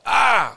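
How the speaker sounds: kort, højt og kraftigt "ah!"; råb op i smerte, spillet for historien; genfortalt smerteråb. Retold or imitated. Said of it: "så jeg råber". (smerteråb)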